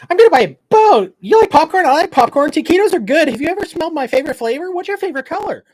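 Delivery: very high, loud, squeaky babble; hyper weirdo voice. Pretend. Host mimicking his Benadryl-wired self, high loud manic voice. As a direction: play a wired, manic oddball. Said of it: "I'll be that weirdo today".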